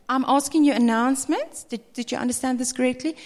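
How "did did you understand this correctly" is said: usual speaking voice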